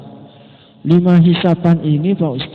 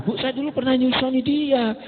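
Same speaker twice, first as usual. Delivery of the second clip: lightly voiced child, higher and softer; soft, higher-pitched plea to a mother; child addressing mother; 9 semitones higher, 5 dB quieter than usual. Child telling mother about having nursed someone, lightly acted higher, soft voice. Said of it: "kata anaknya".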